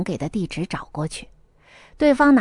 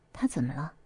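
low, soft murmur; soft question; 9 semitones lower, 11 dB quieter than usual. A narrator's reading. Chengxin softly asks Chongwen what happened, quietly. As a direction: ask it gently under your breath. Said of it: "程心轻声问".

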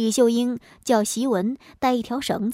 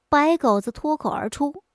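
ordinary delivery, nothing marked

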